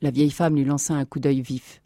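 ordinary delivery, nothing marked

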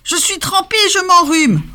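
plainte aiguë du renne mouillé; renâcler, enrhumé; renâclement plaintif. A narrator's reading. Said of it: "renacla Scrogneugneux". (le renne trempé renâcle)

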